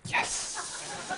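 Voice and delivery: hissed, voiceless little cheer; whispered triumph. Retold or imitated. Artist reads first praise online, whispered triumph. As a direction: celebrate privately under your breath.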